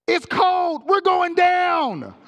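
high, loud frightened cry; panicked yell. Retold or imitated. Passenger panics on the bumpy plane, high loud yell.

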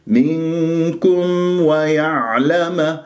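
منكم ويعلم recited slowly and clearly; slow recitation. Shown as a demonstration. Teacher reciting the verse to the class. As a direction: draw out each word like a reciter. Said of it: "لا تنسى الرباعيات".

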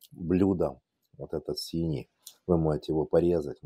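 ordinary talk, nothing marked

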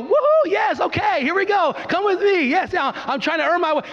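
high, giddy imitation of a do-gooder; mock eager do-gooder. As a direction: play the overeager helper grabbing an old lady. Mocking someone earning heaven.

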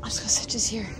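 whispered, trying not to disturb; softly whispered near the nest. Real, not acted. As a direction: whisper so the bird stays calm. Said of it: "Acting casual".

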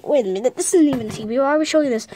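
usual speaking voice

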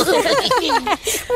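high cheeky story-character laugh; gingerbread man's laugh. A narrator's reading. Gingerbread man laughing at his chasers, high laugh. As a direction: laugh high as the runaway biscuit. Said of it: "gingerbread man just laughed".

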